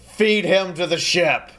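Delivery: loud, high, drawn-out order; in-character commanding shout; barked command. Acted. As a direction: order your underlings around at full volume. Character orders crew after intimidation.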